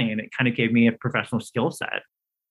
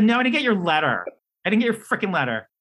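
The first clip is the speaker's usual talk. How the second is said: high-pitched, huffy retort; indignant; 8 semitones higher, faster than usual. Retold or imitated. Applicant snapping back at the NYU caller, indignant. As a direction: retell it as an affronted, snippy comeback. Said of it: "I mean, I was awful".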